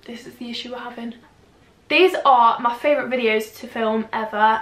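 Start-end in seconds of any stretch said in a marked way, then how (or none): 0.1-1.1 whispering. Real, not acted. Crouching down, hushed aside.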